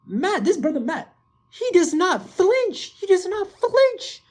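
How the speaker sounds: high-pitched awe, stretching the words out; hyped amazement. Real, not acted. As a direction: marvel in a high voice, drawing out each phrase. Fan hyped over a fearless hero.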